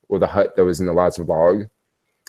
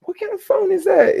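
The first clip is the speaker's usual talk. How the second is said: squeaky question. Retold or imitated. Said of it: "And I'm like".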